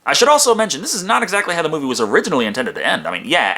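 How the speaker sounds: normal talking voice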